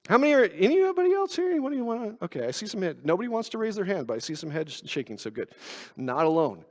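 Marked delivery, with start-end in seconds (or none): none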